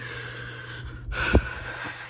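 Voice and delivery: long, soft sigh; tearful sigh. Real, not acted. Crying reader before going back to the book, sighing. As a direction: let out a long breath amid crying.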